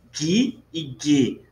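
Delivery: Gi e Ge said "gui e gue"; hard G. Shown as a demonstration. Teacher correcting for students, hard G on Gi and Ge. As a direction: keep the G of Gi and Ge hard as in gato. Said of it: "Então, é Gi e Ge".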